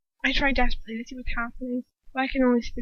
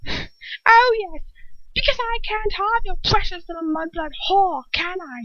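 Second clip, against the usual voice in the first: screaming; 9 semitones higher than usual. A narrator's reading. Bellatrix screaming at Lucius in jealousy. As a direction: scream it bitterly. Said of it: "she screams".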